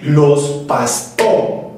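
"los pastó" sung slowly, landing on "pastó"; sung accent demo; accent on the beat. Shown as a demonstration. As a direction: stretch "los pastó" so "pastó" hits beat three. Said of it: "el acento está en".

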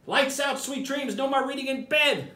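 high, sing-song mama voice; firm but playful lights-out call; Mama telling the monkeys to go to sleep; mama's bedtime order. Acted.

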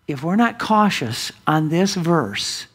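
ordinary talk, nothing marked

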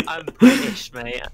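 loud, high, laughing British parody; mock British. Teasing friends in British, laughing loudly. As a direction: ham up the British bloke, laughing. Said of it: "I'm from England, mate".

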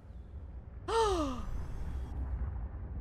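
long sharp intake of breath; startled gasp. Real, not acted. Player startled by the thing, gasping. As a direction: gasp in alarm.

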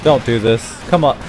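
usual speaking voice

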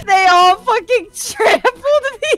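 laughing while complaining. Real, not acted. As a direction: shout through laughter.

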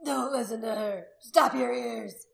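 loud man's order, drawn out at the end; commanding. A narrator's reading. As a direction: order the crowd to shut her out. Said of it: "Gundred commanded".

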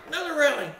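high, loud exclamation of dread; re-enacted loud alarm; acted panic. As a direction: cry out at yet another blow. Reliving railing hits while screaming.

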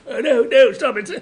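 Doctor panicking as the water rises; Patrick's panicked Doctor. Retold or imitated. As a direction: squeal like the Doctor in a fix. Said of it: "with his face, go".

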